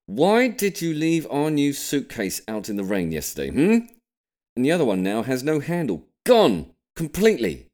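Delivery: high, loud, indignant dad voice; scolding father. Acted. Dad scolding William over the ruined suitcase, loud and indignant. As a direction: tell him off, rising in outrage.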